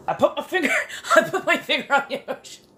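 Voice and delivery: high laughing squeak over the find; laughing squeal. Real, not acted. To chat, laughing high-pitched at naming the feeling. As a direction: giggle it out up high.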